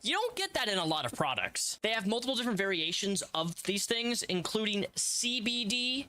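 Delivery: hammed-up sponsor pitch; upbeat salesman voice; ad read.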